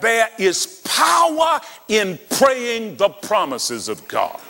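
high, slow, ringing sermon shout; preaching at full force. Acted. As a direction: lift the congregation, slow and soaring. Preaching climax.

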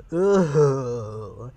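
loud drawn-out exclamation of dismay; exclaimed. Acted. Fussy finds something in the marmalade, exclaimed. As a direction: exclaim it, long and put-on. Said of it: "he exclaimed".

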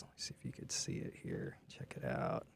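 soft whisper; whispered aside. Real, not acted. Finding the drawing on screen, whispered aside. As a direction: murmur while pulling up the image.

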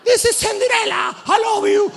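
very high, sugary princess squeal; Cinderella voice. Retold or imitated. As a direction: gush it like a costumed princess. Mocking Cinderella character greeting. (voz chillona de Cenicienta)

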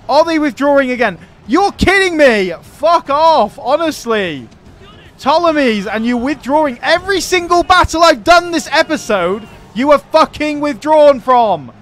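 loud, furious ranting at fleeing enemies; angry rant. Real, not acted. Gamer ranting at retreating AI armies, real furious shouting. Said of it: "I wasn't expecting to get such a rant in today".

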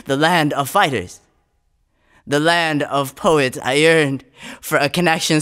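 intense, rising performance poetry delivery; spoken word poem. Acted. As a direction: perform the poem with mounting urgency.

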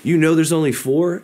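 jolted, high and loud disbelief; surprised. Retold or imitated. Sergeant startled by recruit's quick answer, high surprised blurt. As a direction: jump in your seat and blurt it out. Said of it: "jumped in his seat and he went".

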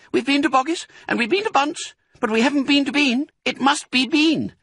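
put-on very high child voice; smallest fox cub. A narrator's reading. Smallest fox works out the next raid, piping up. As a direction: reason it out like a keen child. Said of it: "said the smallest fox".

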